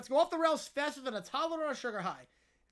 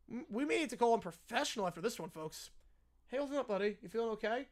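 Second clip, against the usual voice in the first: usual speaking voice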